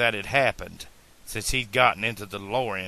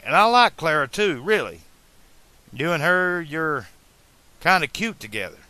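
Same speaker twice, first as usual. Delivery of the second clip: higher-pitched, tactful reasoning; careful; 5 semitones higher, 4 dB louder than usual. A narrator's reading. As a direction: pick your words gently but earnestly. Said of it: "choosing his words carefully".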